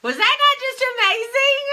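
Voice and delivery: high, bright burst of delight to the audience; gushing excitement. Real, not acted. Host to viewers, right after the call: gushing excitement.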